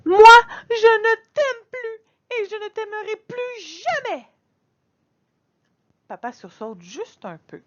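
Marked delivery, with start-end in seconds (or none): 0.1-4.3 high, loud, sobbing shout; tearful yell. Acted. Girl hurling her cruelest line at her father, sobbing shout. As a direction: scream it through tears to wound him. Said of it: "la phrase qui tue".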